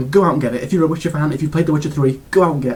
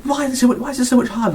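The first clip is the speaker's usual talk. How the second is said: mock exasperated question, pitched higher; 10 semitones higher, faster than usual. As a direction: whine it like a frustrated player. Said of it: "I was like".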